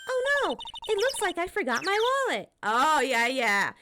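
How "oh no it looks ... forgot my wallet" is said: put-on higher, sheepish girl voice; Maya character voice. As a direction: fake an innocent slip, pitched up. Maya's line, high voice.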